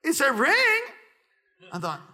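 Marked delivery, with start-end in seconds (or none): 0.0-0.8 put-on kid's voice. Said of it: "And he's like".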